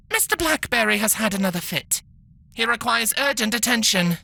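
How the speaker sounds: a tinny posh robot nurse. Acted. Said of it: "very posh-sounding foreign accent called out. It sounded tinny".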